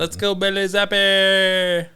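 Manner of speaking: drawn-out hype chant; slow cheer. Real, not acted.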